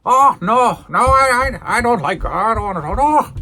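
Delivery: mock-terrified, high stammering refusal; Lord Alfred. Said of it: "Lord Alfred is terrified".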